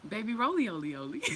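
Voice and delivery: repeating it through laughter; amused repeat; laughing. Real, not acted. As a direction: giggle the words out. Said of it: "wait, what?".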